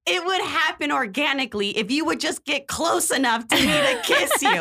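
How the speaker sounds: high, strained imitation of an indignant date; mock yelling. Date demanding a kiss in the car, mock yelling. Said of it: "he yells and is like".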